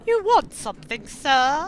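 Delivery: high, loud put-on telephone voice; posh telephone voice. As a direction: play up a posh phone manner, pitched high. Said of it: "you meant my telephone voice".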